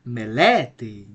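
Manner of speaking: "melete" peaking on LE, then falling away; accent on middle syllable. Shown as a demonstration. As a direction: punch the LE in "melete". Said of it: "The right pronunciation of this word".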